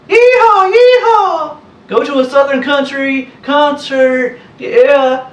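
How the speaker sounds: high, loud, hammed-up show-off twang with yee-haw whoops; mocking wannabe country. Mocking fake country people who brag, loud high hammed-up voice. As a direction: parody a poser begging to be seen as country. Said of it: "ever go out of my way to say".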